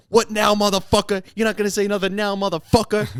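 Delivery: high, loud taunting threat; retold angry gangster taunt; menacing thug. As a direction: loom over the kid and taunt him. Armed driver threatens the boy.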